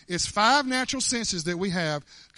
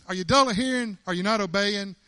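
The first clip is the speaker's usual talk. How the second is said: ordinary delivery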